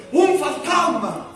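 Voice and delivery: grito de desesperación. A narrator's reading. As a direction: cry out slowly in desperate dread. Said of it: "grito, desesperación". (grito desesperado, alto y alargado)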